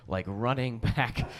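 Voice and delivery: usual speaking voice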